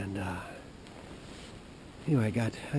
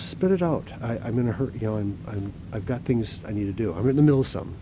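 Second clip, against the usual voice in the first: impatient official; 7 dB louder than usual. Retold or imitated. Official impatiently cuts off rambling caller, louder. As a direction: snap at the caller to hurry.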